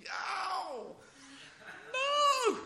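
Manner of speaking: very high squeal of fright; mock shriek. Mothers facing childbirth, a pretend terrified squeal.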